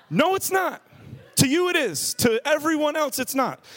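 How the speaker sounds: very high, comic protest; hammed-up exasperated retort; exasperated contradiction. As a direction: squeal the correction in mock disbelief. Contradicting drunk's spinning claim.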